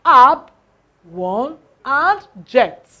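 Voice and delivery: "Ab" punched very loud, then "ject"; syllable split. Shown as a demonstration. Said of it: "two syllables".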